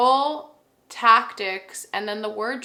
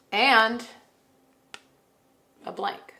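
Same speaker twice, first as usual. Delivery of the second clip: usual speaking voice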